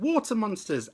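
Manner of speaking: very high, loud alarmed shriek; frightened cry. A narrator's reading. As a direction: shriek in panic at the water. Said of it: "and one cried".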